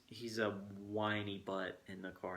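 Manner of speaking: ordinary talk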